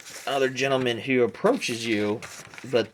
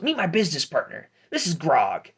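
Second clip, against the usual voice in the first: higher, quick halfling hustler; Pete; 6 semitones higher, 4 dB louder than usual. Acted. Halfling introducing his business partner, hustler's patter. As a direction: rattle it off in a raised peddler voice. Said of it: "And Pete goes".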